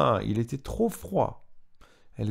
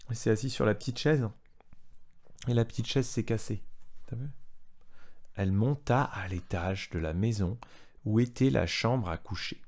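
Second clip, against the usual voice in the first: ordinary delivery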